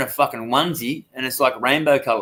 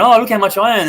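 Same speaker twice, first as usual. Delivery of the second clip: put-on high, louder, showing-off girly voice; mock boastful girl; 7 semitones higher, 5 dB louder than usual. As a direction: brag in a silly high voice like a show-off. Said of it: "And she's going".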